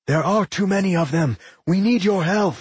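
high-pitched urgent plea; crying out. A narrator's reading. Gran begging Fiora for help against the horde, crying out. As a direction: call across a battle for rescue. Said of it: "Gran cried out".

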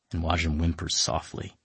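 ordinary delivery

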